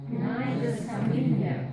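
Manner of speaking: loud, slow, pushing each word; powerful repeat. Shown as a demonstration. Student repeating the phrase with power to interrupt. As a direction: say it with power to break in. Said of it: "Does it need a little bit of power".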